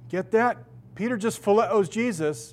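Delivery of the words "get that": usual speaking voice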